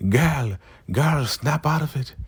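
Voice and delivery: high-pitched mock reprimand; acted urgent scolding. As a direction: try to jolt a friend out of it, pitched high. [name] scolds the laughing Zane.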